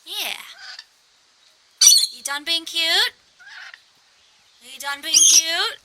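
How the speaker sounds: high, sing-song pet voice. Real, not acted. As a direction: coo at the bird playfully. Baby talk to bird.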